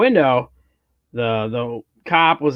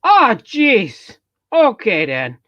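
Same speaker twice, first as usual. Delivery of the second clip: loud, high-pitched hammed-up Minnesotan; exaggerated Minnesota stock phrases, sung-out and high; Minnesota accent; 9 semitones higher, 5 dB louder, much slower than usual. Shown as a demonstration. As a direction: lean hard into a cartoon Minnesota accent. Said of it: "I can do certain phrases".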